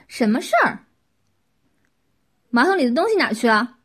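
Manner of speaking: loud, sharp angry demand; enraged. A narrator's reading. Qin confronting the kneeling neighbours at their door, loud and furious. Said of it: "顿时怒了".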